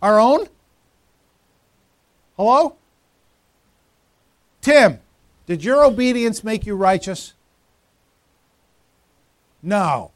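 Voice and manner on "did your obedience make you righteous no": ordinary talk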